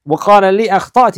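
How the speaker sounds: a quick retold rebuke; mock scolding. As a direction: scold the student sharply. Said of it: "he scolded me".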